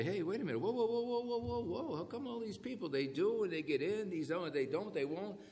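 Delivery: high-pitched mock protest of whoa, whoa; a lightly voiced baffled skeptic; puzzled objector. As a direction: act out a confused listener objecting. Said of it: "and you say".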